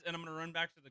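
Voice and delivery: ordinary delivery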